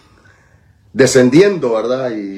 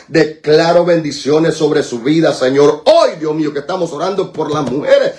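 raised, insistent praying voice; fervent blessing prayer; 7 semitones higher than usual. Real, not acted. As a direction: declare a blessing over her with fervor. Prays a birthday blessing. (oración de bendición con voz elevada)

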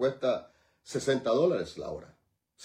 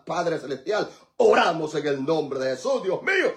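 loud, unbroken intercessory praying; fervent prayer; 5 semitones higher, 4 dB louder than usual. Real, not acted. Pastor praying for towns and countries, fervent prayer. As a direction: pray blessing over the land with insistent force.

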